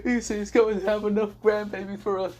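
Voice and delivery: hammed-up weeping parents; parents crying. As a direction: parents blubbering over grandbabies, a mock cry. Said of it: "crying and saying".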